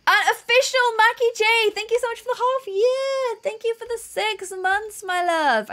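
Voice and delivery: high-pitched streamer thank-you; high, gushing thanks; subscriber shout-out. Real, not acted.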